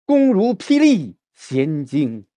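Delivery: 霹雳 weighted in 弓如霹雳弦惊, slow; slow, forceful weight on 霹雳; stress on 霹雳. Shown as a demonstration. Teacher models the line for the student. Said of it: "再来".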